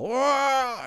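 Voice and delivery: high yelp as he falls; loud yell. Acted. Mister Otter yelling as he tumbles from the tree. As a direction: cry out as the branch breaks. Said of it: "they heard a loud yell".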